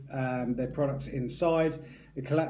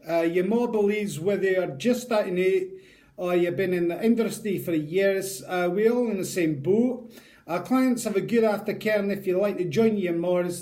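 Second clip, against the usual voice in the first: put-on Scottish accent; 2 semitones higher than usual. Vlogger reading the maker's sales blurb, in a hammed-up Scottish accent. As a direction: pitch the aftercare like a Scottish ad. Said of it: "that is the last time I'm gonna be doing a Scottish accent".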